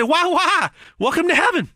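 put-on high drawled Southern accent voice; God greeting you in a Southern accent. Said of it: "He might have a Southern accent".

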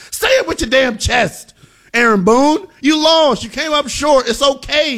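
high-pitched, exasperated rant; worked-up tough-love telling-off; fired-up scolding. Real, not acted. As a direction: scold the manager in a high, heated voice.

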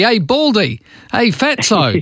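high, jokey call across to a mate; mock teasing call. Aussie mates calling each other by rude nicknames, cheeky call.

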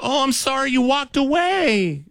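high, slow, cracking apology. Retold or imitated. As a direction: let the voice crack upward on 'sorry'. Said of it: "my voice cracked too".

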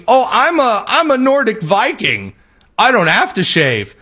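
mock voice of a recruit claiming to be a Viking; mocking high voice. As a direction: send up the recruit's excuse in a silly high voice.

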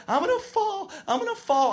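put-on tiny high-pitched kid voice; whining scared kid. Kid who doesn't want to skate, high anxious whine. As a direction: squeak it like a reluctant child.

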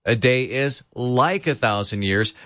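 "like" stressed as the key word. Shown as a demonstration. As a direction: lean on "like" to show comparison. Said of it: "Emphasis on the word like".

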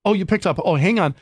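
fake-casual robocaller. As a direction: imitate a robot caller pretending to be caught off guard. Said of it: "They're doing something kinda casual".

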